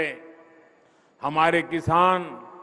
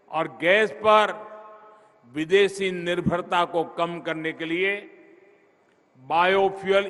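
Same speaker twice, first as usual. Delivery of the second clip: ordinary talk, nothing marked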